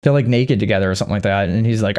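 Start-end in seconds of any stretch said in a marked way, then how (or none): none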